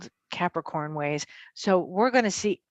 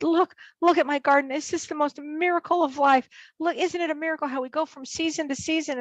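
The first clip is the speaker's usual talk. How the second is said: higher-pitched imitation of an enthusiastic friend; marvelling; 7 semitones higher than usual. Best friend on FaceTime from his garden, marvelling. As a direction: retell his wonder in a lifted voice.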